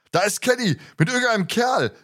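high-pitched narrated call, no real volume; calling back. A narrator's reading. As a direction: call back urgently in a storybook voice. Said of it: "rief Peter zurück".

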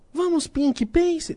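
high cartoon coaxing voice; cartoon character voice. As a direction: squeak it like a cartoon.

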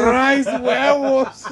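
mocking drawn-out "Rice, huevos"; slow mock echo of "Rice, huevos"; mimicked pronunciation. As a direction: stretch "Rice, huevos" out very slowly, imitating a friend's odd pronunciation. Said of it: "Why you say it like that".

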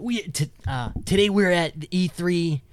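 high, slow, eager convention reporter; excited game reporter. Retold or imitated. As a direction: gush like a nerdy event host. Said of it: "those reporters".

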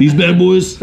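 high, hammed-up karate instructor; Rex Kwon Do. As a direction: show off like a martial arts teacher. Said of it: "I sound like Rex Kwon Do".

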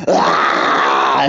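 goofy shouted ah. Acted. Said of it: "getting loosey-goosey and goofy".

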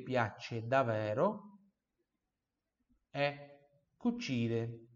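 slow, spaced teacher drill; slow model phrase. Shown as a demonstration. Teacher drilling the phrase slowly, twice. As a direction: draw it out so students can follow.

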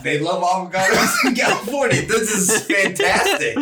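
high, delighted words through real laughter; laughing. Real, not acted. Cohost cracks up at the surfer impression, laughing. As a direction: talk while cracking up.

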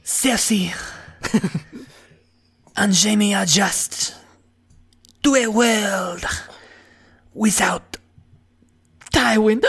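slow, hammed-up Spanish accent; mock-dramatic Spanish accent; Spanish soap opera. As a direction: stretch it out like a Spanish telenovela, pausing for drama. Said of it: "Spanish soap opera".

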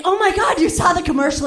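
mock thrilled gush; excited. Comic answers the neighbor's wife, eager and excited.

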